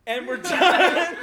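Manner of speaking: high, drawn-out shout through laughter; retold loud ending. Castmate retelling how the rant ended, loud and laughing. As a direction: belt it out slowly, laughing.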